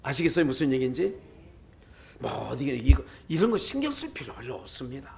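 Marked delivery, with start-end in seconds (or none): none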